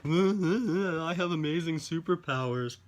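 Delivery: high, slow mocking whine; whiny mockery of his complaint; mock whining. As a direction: sneer it out like a self-pitying sulk. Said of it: "constantly whining about".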